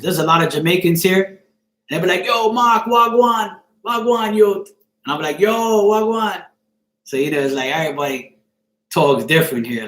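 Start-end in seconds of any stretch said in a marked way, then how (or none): 2.2-4.6 higher, called-out Jamaican greeting; Jamaican accent. Retold or imitated. Jamaican friends greeting Mark, imitated Jamaican accent. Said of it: "I got a lot of Jamaican friends". 5.4-6.4 put-on Jamaican accent. Answering his Jamaican friends, imitated Jamaican accent. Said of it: "I got a lot of Jamaican friends".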